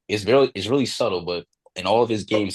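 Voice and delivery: usual speaking voice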